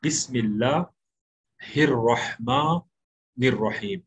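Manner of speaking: very slow teaching recitation with long vowels, pausing between parts; slow model recitation. Shown as a demonstration. Teacher asks students to listen and copy, slow model recitation. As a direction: stretch बिस्मिल्लाहिर्रहमानिर्रहीम out slowly, part by part. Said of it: "वैसा ही पढ़ने की कोशिश करें".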